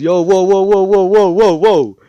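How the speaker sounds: high, loud rapid-fire whoas; hammed-up fatherly alarm; alarmed dad interrupting. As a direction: cut in to stop everything. Dad halts the excited mom.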